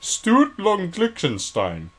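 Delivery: high, loud, slow mock British accent; Stuart. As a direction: draw the name out in high-pitched British. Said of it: "Can you do a British accent?".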